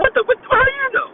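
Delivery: high, loud mock outrage, caught off guard; retelling his shock that the camp knew his secret; shocked disbelief. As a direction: burst out in startled disbelief, pitch jumping up. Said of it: "I'm like".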